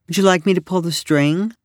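a hint of a helpful stallholder; lightly voiced booth owner. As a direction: offer it as a question, voice lifted. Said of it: "the booth owner asked".

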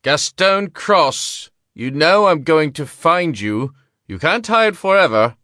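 high, loud menacing call. A narrator's reading. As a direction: call out to him, taunting. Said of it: "the gambler called out".

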